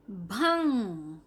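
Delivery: slow, stretched भंग with a held nasal; teacher modelling भंग slowly; drawn-out model word. Shown as a demonstration. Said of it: "के ऊपर बिंदी है".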